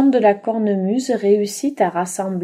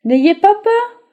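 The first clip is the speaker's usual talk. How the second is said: high, loud kindly call; reassuring shepherd; 11 semitones higher, 7 dB louder than usual. Acted. Shepherd calling to the frightened animals, reassuring. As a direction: call out to calm them.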